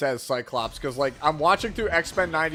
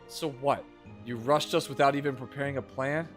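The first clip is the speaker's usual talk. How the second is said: bad acting; 5 semitones lower, 6 dB quieter than usual. Reads game dialogue aloud.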